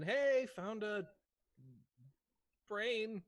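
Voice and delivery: loud, high call with drawn-out words; high-pitched shout, stretched out; yelling out. Acted. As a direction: holler it across to a companion. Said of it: "I'll yell out for Anne".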